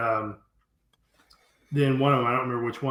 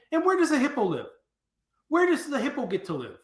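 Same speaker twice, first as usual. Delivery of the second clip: pointed question; 12 semitones higher, faster than usual. Retold or imitated.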